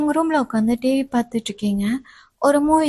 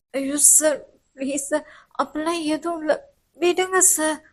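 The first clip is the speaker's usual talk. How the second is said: higher, softer alarmed pleading; alarmed student; 3 semitones higher, 3 dB quieter than usual. Acted. Student begging the professor to stop kissing her. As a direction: push him away, begging.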